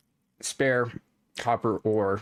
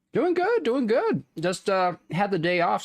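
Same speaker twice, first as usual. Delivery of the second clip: much higher, lively reply; high happy voice; 11 semitones higher than usual. Real, not acted. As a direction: pitch it way up, cheerful. Answering chat, high and bright.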